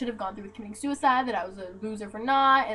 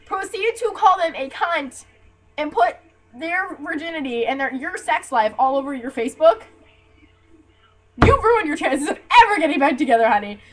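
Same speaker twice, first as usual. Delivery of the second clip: loud, higher, emphatic scolding; indignant telling-off; 4 semitones higher, 7 dB louder than usual. Acted. Answering the ex's plea to reunite, indignant telling-off. Angry retort to the apologizing ex.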